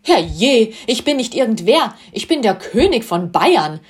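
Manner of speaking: loud, indignant royal protest; a king declaring himself, appalled; outraged shout. A narrator's reading. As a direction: shout his title in wounded pride. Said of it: "schrie Ludwig entsetzt".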